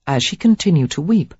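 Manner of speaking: plain reading voice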